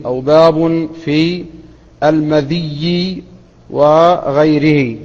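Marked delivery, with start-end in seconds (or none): 2.0-3.2 "المذي" drawn out with a doubled ya; تشديد الياء. Shown as a demonstration. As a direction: say "المذي" slowly as al-ma-DHIYY-i. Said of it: "وبكسر الذال وتشديد الياء". (نطق "المذي" بكسر الذال وتشديد الياء)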